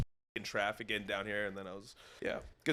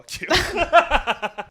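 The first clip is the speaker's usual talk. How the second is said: laughing; 11 dB louder than usual. Real, not acted. Laughing reveal.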